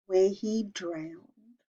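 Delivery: ordinary delivery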